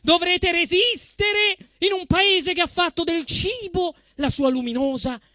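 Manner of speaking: ordinary talk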